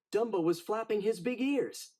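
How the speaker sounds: ordinary delivery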